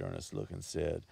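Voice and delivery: plain reading voice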